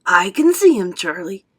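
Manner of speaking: a touch of excitement; loud opening, then settling; understated thrilled grandpa; excited. A narrator's reading. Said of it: "said Grandpa Joe excitedly".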